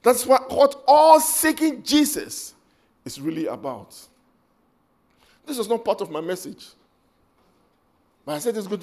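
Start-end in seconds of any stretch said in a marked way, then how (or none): none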